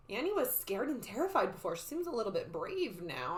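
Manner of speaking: usual speaking voice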